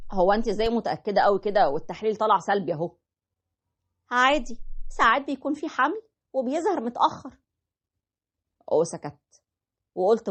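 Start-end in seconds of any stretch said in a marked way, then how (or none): 4.1-7.4 higher wife voice giving in; wife agreeing. Retold or imitated. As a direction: answer as the wife, pitched up. Wife voiced in retold story. (صوت الزوجة وهي بتوافق)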